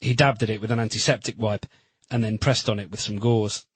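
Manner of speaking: ordinary delivery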